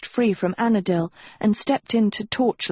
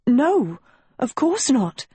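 stuttering; 6 semitones higher, slower than usual. A narrator's reading. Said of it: "Agatha stuttered".

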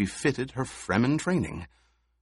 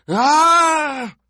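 sudden high yelp of pain; pained cry; 9 dB louder than usual. A narrator's reading. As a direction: cry out as the poison strikes. Baron stung by needle.

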